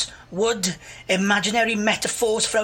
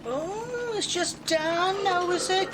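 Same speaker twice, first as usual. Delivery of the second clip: drawn-out, knowing tease; slow sing-song ribbing; mock teasing; 6 semitones higher, much slower than usual. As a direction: rib her about her new friend. Teasing about friend Dan.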